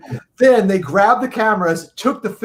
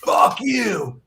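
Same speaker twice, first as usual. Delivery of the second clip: higher-pitched mock yell, held back in volume; mild mock-angry holler; retold angry curse; 4 semitones higher than usual. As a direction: imitate a yell heard down the hallway. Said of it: "I hear Dave go".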